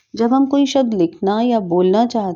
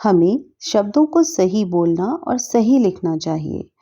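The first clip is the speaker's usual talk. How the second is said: ordinary talk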